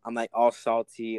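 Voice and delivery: ordinary delivery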